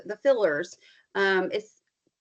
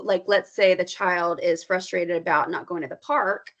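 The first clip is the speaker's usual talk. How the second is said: ordinary talk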